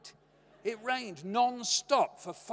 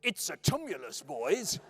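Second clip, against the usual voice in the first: lightly mocking imitation of Dad; a hint of put-on dad; dad's voice; 3 dB louder than usual. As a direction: mimic a father grandly announcing a find to his sons. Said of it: "puts on his dad's voice".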